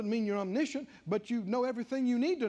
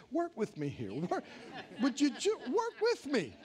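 the Lord, amused; 3 semitones higher than usual. Retold or imitated. The Lord pleading with the body of Christ, laughing. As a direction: plead gently with a chuckle. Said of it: "hear the Lord saying".

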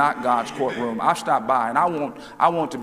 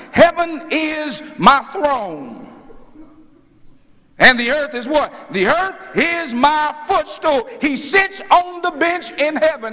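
preaching; 9 semitones higher, 4 dB louder than usual. Real, not acted. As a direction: preach it at full force, stretching the big words. Preaches at full force.

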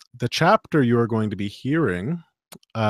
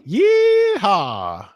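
cowboy yeehaw; 9 semitones higher, 3 dB louder than usual. Pretend. Cowboy whoop.

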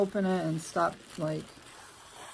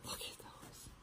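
very soft, gushing whisper; adoring whisper; 22 dB quieter than usual. Real, not acted. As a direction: whisper it, melting over cuteness. Gushes over the gifted pants.

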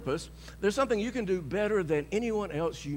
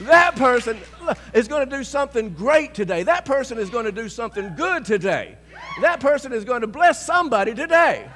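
higher and louder, rising to a cheer; excited self-talk; 6 semitones higher, 12 dB louder than usual. Shown as a demonstration. Believer talking to themselves in the mirror, exuberant. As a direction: hype yourself up in the mirror. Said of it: "walk into that mirror and say".

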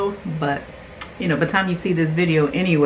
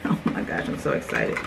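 low, voiceless excited near-whisper; hushed excitement; 7 dB quieter, faster than usual. Real, not acted. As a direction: whisper the excitement under the breath.

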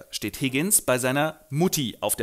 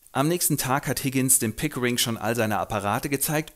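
ordinary delivery

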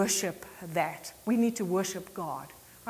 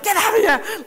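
high, loud laughing exclamation; mock disbelief; 11 semitones higher, 8 dB louder than usual. Friend reacting to his moves, high laughing disbelief. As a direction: toss it off through laughter. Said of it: "one of those, like".

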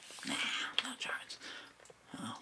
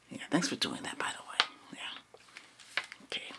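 whispering; 5 dB quieter than usual. Acted. ASMR whisper.

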